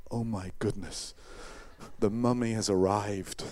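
low, ominous awe; mock dread. Onlooker sees the wrapped man emerge, mock dread. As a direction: announce it like a horror-film onlooker. Imagined crowd aghast at the mummy.

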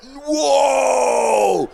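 long, loud drawn-out whoa; mock amazed shout. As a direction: bellow it and stretch it out. Cat's loud boast to other cats.

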